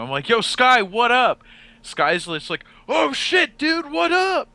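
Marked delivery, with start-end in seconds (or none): 0.3-1.4 calling out. Retold or imitated. Greeting Sky loudly at a rave. 2.9-4.5 much higher, louder imitation of a drunk friend; high-pitched party greeting; mock Sky greeting.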